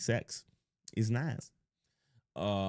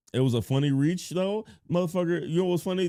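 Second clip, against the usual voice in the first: ordinary talk, nothing marked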